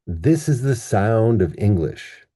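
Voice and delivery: "this is the sound of English" with three stressed beats on this, sound, Eng-; sentence-level word stress. Shown as a demonstration. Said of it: "We stress this word".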